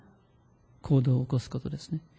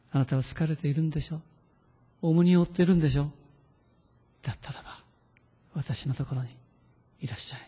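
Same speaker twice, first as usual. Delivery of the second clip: softening to a hushed, gentle call; tender invitation; 4 dB quieter, faster than usual. Acted. Jesus gently inviting the tired listener, hushed and tender. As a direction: invite the weary in gently, fading near silent.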